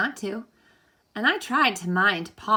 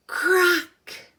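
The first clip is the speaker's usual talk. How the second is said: high, loud sudden crack; sharp crack; 11 semitones higher, 6 dB louder than usual. Acted. Reader voices the egg cracking in the cave, sharp and high. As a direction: make the crack jump out.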